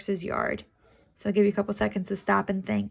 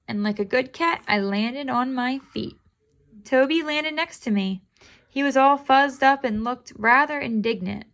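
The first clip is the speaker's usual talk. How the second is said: squeaky kitten meow, pitched way up; 3 semitones higher, 4 dB louder than usual. Acted. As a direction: cry it out like a little cat. Reads the story aloud.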